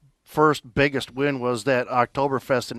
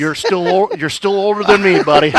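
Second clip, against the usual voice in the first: loud teasing; 5 semitones higher, 9 dB louder than usual. Real, not acted. Host teases guest about age, loud and laughing. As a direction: tease him loudly, grinning.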